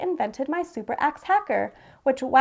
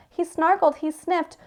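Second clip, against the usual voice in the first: plain reading voice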